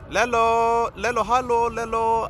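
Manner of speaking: put-on high, loud, playful German-accented greeting. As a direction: call the name out in a mock German accent. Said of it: "I'm talking to you like a German".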